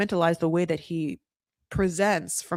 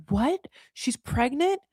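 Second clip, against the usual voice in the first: mock shock; 6 semitones higher, 3 dB quieter, slower than usual. Producers shocked, retold.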